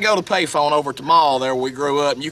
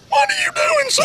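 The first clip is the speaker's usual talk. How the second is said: boss's high-pitched voice. Retold or imitated. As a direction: shriek it like an angry fat boss. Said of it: "and he'd go".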